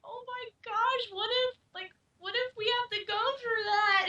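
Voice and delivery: high, slowed, teary voice, only half serious; mock emotional. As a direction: playfully act out a tearful panic about the future. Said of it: "we get all emotional".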